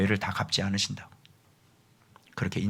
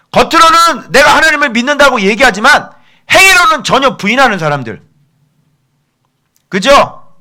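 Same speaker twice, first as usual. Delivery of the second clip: loud, high-pitched accusing sermon; full-force preaching; 14 dB louder than usual. Real, not acted. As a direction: thunder the rebuke at the congregation. (강하게 질책하는 고음의 설교)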